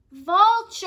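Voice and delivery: high, loud call across the distance. Acted. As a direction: shout her name to find her. Birdie calling for his friend.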